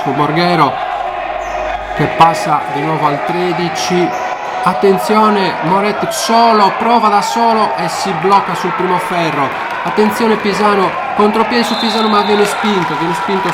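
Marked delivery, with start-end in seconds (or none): 4.6-13.5 high shouted live call; breathless italian goal commentary. Acted. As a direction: shout the last seconds play. Late game excitement.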